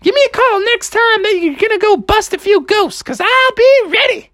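high falsetto, eager cartoon mother; high-pitched keen mom; Froggy's mom. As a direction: gush in a squeaky mom voice. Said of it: "she's like".

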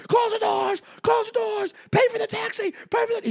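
high frantic mock yell; hammed-up panicking cabbie; panicked yelling. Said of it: "He's freaking".

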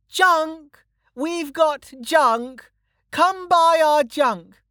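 very high, drawn-out hawker's cry; a little girl calling junk for sale across a market; hollering child. A narrator's reading. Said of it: "took a deep breath and hollered".

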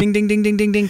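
high, even, repeated dings; mock bell dinging. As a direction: imitate a timer bell going off. Imitates a game buzzer going off.

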